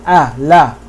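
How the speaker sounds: "Ah, la" with the a of "cat"; thin, fronted vowel in "la", like "bat"; light fatha vowel. Shown as a demonstration. Teacher comparing the fatha to English cat, thin vowel demonstrated. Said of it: "Very similar sounding".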